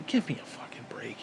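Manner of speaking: exasperated whisper. Real, not acted. Fed up with hypocrisy, whispers the complaint. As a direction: breathe it out in disgust.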